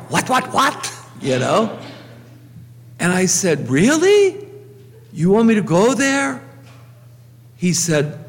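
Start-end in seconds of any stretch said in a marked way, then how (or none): 0.1-0.9 stunned disbelief. Retold or imitated. Stunned by chaplain appointment news. 3.7-6.4 high, startled disbelief; incredulous surprise. Retold or imitated. Priest asking the personnel director, shocked and high.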